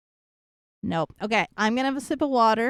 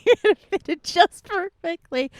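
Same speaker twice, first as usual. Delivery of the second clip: higher, playful sing-song repeat; squeaky repeat; 8 semitones higher, 5 dB louder than usual. Acted. To a new chatter, a teasing higher repeat. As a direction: say it again, pitched up and teasing.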